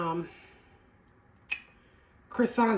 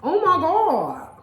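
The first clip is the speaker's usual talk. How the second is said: loud, slow, drawn-out oh my God; overwhelmed by the taste; loud delight; amazed; 6 semitones higher, 7 dB louder, much slower than usual. Real, not acted. Tasting the turnover.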